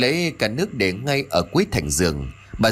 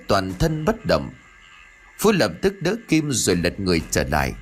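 usual speaking voice

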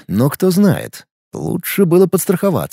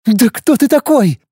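she loses patience, pitched up; exasperated woman; 9 semitones higher than usual. Acted. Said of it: "не выдержала девушка".

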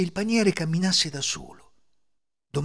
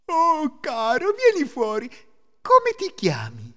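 high, loud delighted welcome; overjoyed mother; 13 semitones higher, 7 dB louder, slower than usual. A narrator's reading. Happy mother greeting her hidden child, overjoyed. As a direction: coax the little one out happily. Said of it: "La donna fu tutta felice".

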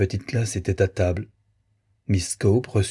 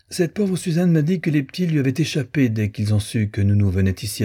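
put-on higher, mannered society-girl apology; a polished, worldly girl making excuses; lightly voiced fashionable girl, apologetic; affected young lady; 6 semitones higher than usual. Blanca apologizes to her sister.

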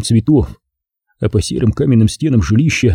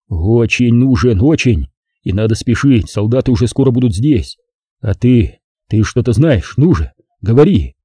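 ordinary delivery, nothing marked

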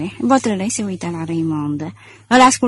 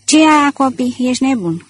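higher, louder astonished retort; astounded; 5 semitones higher, 5 dB louder than usual. A narrator's reading. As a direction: react as if stunned by the very idea. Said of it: "Lupin îl privi uluit". (replică uluită, pe ton ridicat)

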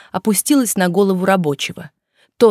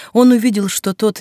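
ordinary delivery

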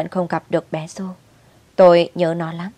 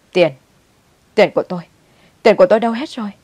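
grandmother's alarmed cry, rising louder; mock wail; 3 semitones higher, 6 dB louder, much faster than usual. A narrator's reading. Grandmother discovers her money is gone, alarmed wail. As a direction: cry out over missing money, voice climbing. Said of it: "Bà gào lên".

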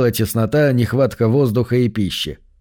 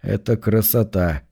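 gloomy; 4 semitones lower, slower than usual. A narrator's reading. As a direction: say it grimly to yourself. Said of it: "хмуро подумал Хара".

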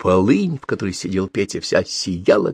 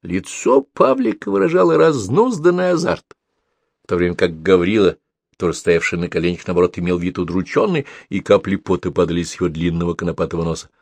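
ordinary delivery

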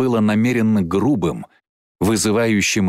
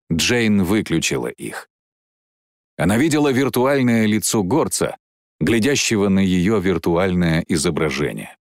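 ordinary delivery

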